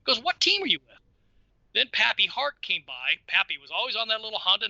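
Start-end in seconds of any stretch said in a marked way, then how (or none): 0.2-0.9 higher-pitched mock interrogation; retold challenge. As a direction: imitate an official challenging a kid. Retold official challenging a kid.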